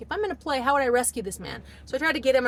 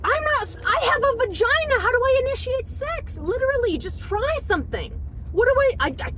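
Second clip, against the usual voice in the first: exasperated outburst; 13 semitones higher than usual. Retold or imitated. Wife arguing with husband about initiating, exasperated high outburst. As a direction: wail the complaint at a high pitch. Acts out frustrated quarrel with husband.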